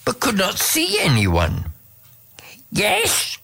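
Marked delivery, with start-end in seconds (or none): 2.7-3.4 much higher, a small chirpy frog; eager frog voice. A narrator's reading. Frog answers the unseen voice, high and eager. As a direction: pipe it up like a little frog. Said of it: "said the frog".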